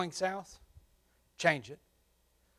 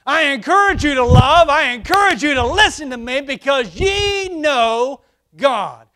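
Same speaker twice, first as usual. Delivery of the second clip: shouted, high exhortation; commanding father; 8 semitones higher, 13 dB louder than usual. Retold or imitated. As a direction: call them to love like a commanding father. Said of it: "the commanding father".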